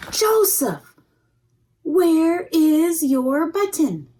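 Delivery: high, loud alarmed call; mother calling. Acted. Mother calling loudly to Joseph about the missing button.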